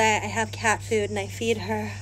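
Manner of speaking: normal talking voice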